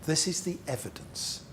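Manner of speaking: normal talking voice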